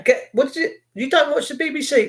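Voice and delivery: ordinary talk, nothing marked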